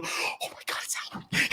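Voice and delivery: hushed fan gasping at a celebrity; breathless, voiceless whisper of excitement; excited whisper. Acted. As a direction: whisper in starstruck disbelief. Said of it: "talking with each other really excitedly".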